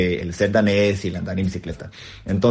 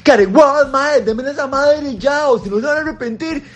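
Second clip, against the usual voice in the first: yelling at thieves. Retold or imitated. Tico yells at thieves from his bike, high shouting threat. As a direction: yell to scare the thieves off. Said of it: "les empiezo a gritar de la cleta".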